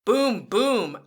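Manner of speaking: loud boom boom; booming tank. Shown as a demonstration. Teacher booming like a tank, loud. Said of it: "your turn to make the sound".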